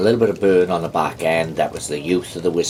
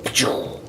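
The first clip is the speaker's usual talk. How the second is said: whispered puff of a vanishing genie; mock magic poof sound. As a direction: blow out a quick whispered pachoo like smoke clearing. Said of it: "The genie goes".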